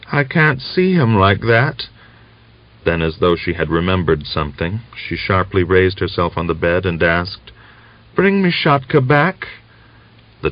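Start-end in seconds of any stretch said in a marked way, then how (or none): 0.1-1.9 delirious. A narrator's reading. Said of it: "as though delirious". 8.1-9.5 higher voice of a sick wife on the mend; relieved but frail, pitched up; weak young woman. A narrator's reading. Dying Natalia asks for son.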